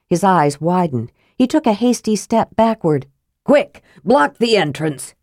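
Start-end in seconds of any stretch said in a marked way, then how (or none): none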